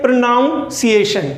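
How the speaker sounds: "प्रोनाउंसिएशन" with an "ow" in the second syllable; mispronounced pronunciation. Shown as a demonstration. Teacher repeating what some people say, "प्रोनाउंसिएशन" mispronounced with NOUN. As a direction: say "प्रोनाउंसिएशन" as pro-NOUN-si-ay-shun. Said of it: "क्या बोलते हैं?".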